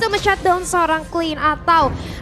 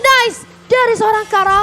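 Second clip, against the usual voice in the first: higher, louder hype call; fighting game announcer; 6 semitones higher, 4 dB louder than usual. Real, not acted. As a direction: hype up the big play. Caster hyping a triple kill.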